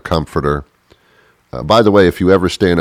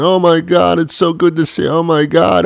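gushing joy; 11 semitones higher than usual. Retold or imitated. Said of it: "hugs and kisses and".

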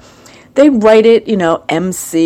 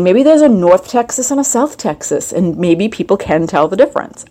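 ordinary delivery

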